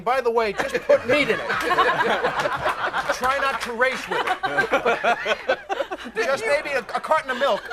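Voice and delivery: mock label read through giggles; a fake safety notice, laughing; laughing mock warning label. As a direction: chuckle through the warning text. Reads imagined warning label.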